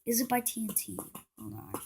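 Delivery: very soft, fading almost to a whisper; muttering to self. Real, not acted. Vlogger checking a firework's maker, muttering quietly to self. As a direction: murmur it under your breath while checking.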